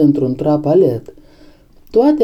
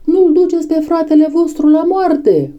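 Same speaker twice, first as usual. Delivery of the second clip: high, crying appeal; desperate plea; 11 semitones higher than usual. A narrator's reading. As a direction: beg them in a high outcry. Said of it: "țipară ele". (implorare strigată, pe ton înalt)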